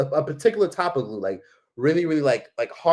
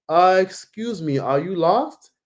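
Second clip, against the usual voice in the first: higher, drawn-out polite question; concerned stranger; 5 semitones higher, slower than usual. Retold or imitated. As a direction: mimic a stranger cautiously approaching. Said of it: "there was this one guy".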